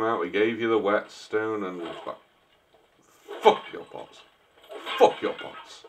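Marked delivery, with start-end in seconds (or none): 3.4-4.2 unvoiced rude whisper. Whispered rude aside at NPC. 5.0-5.8 "Fuck your pots." fully whispered again. Acted. Repeated whispered aside.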